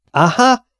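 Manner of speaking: high, loud triumphant yell; captain's shout. Acted. Captain shouts at Robert Smith, triumphant. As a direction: roar it out in triumph. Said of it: "valósággal felordított".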